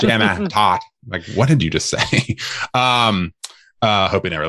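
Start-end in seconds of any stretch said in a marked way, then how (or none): 0.0-0.8 Jamie Tot in an imitated thick British accent; mock thick British accent. Co-host mocks the player's accent, laughing. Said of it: "His accent is so thick".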